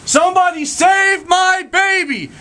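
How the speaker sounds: high, slow cry with the stress on save; desperate yell. Acted. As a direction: wail it out over the smoke and wind. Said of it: "you hear again".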